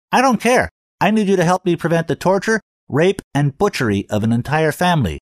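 high cartoon voice, loud; comedy voice. A narrator's reading. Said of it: "character he sounded like".

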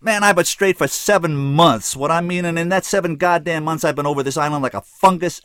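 loud, high-pitched outraged rant; exploding. A narrator's reading. Bottled-up kid rails at his life, exploding. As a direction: let everything bottled up burst out. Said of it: "He exploded".

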